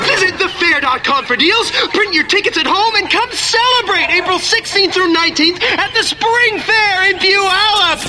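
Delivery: ordinary delivery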